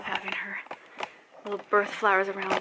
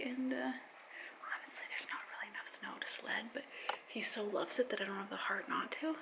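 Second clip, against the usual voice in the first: soft hushed whisper; 13 dB quieter than usual. Real, not acted. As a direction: keep it to a whisper. Aside while baby nearby.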